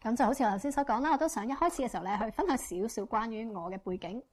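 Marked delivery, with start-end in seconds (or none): none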